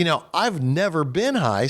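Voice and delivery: usual speaking voice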